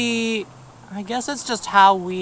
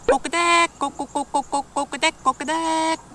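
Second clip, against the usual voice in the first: clucking Kokodek, a little higher; Korean hen cluck; 6 semitones higher, faster than usual. Shown as a demonstration. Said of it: "Korean goes".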